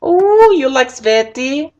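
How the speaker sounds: very high, slow put-on voice; silly character voice. Gamer clowning after an accent demo, silly high voice. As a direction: coo it high and drawn out.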